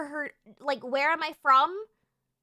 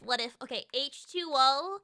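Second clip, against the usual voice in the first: ordinary talk, nothing marked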